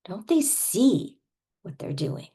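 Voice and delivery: hammed-up exasperated question; over-the-top indignation. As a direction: plead in played-up disbelief. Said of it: "we're gonna go over the top".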